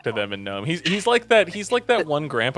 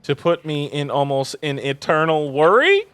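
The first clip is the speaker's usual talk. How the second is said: rising, louder exasperated scolding; worried scolding mother; 6 semitones higher, 5 dB louder than usual. Acted. Mother scolding her reckless son Paul, exasperated. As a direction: lay the guilt on thick as a worried mum. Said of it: "And she said".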